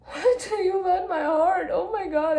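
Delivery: high, laughing near tears; laugh-crying. Real, not acted. Reader overwhelmed by a romantic line, laugh-crying. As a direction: squeal it through happy tears.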